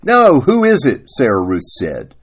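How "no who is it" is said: loud, high challenge; raised-pitch acted retort; quarrelling wife. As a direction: throw the question back at him.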